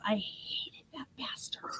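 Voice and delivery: slow, hushed whisper. Retold or imitated. Four-year-old confessing turkey hatred to mom, whispered. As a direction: whisper the swear like a naughty confession. Small child's secret curse, retold.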